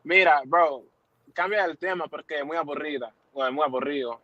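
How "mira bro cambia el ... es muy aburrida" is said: ordinary talk, nothing marked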